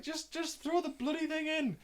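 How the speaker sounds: very high-pitched pleading; acted-out desperate squeal of frustration; exasperated plea.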